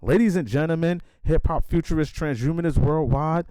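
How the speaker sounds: loud, high hype-man podcast intro; announcer intro. Acted. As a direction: pump up the show opening like a radio host.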